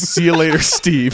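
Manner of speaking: mildly projected lisping farewell; mock lisped shout. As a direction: call out with a lisp on the S of STEVE. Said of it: "I shout at him with a lisp".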